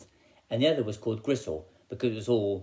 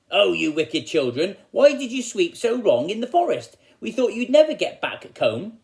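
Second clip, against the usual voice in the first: high, louder hammed-up scolding; mock cross; 9 semitones higher, 7 dB louder than usual. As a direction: tell the children off like a panto villain. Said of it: "but Wolf was very cross".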